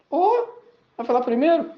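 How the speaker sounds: high, surprised "Ô"; startled question. Retold or imitated. Max startled when his friend jumps in first. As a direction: caught off guard and a little indignant.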